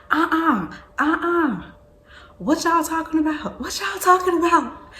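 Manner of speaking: high nosy "uh-uh", demanding what's up; suspicious sister. Retold or imitated. Aaliyah catching her sister whispering, high nosy demand. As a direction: call them out, high and suspicious.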